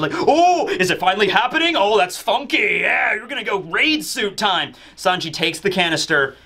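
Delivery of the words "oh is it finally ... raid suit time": high, giddy villain; giddy Queen. Retold or imitated. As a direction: squeal with eager glee, then settle. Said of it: "Queen gets really giddy, gets really excited".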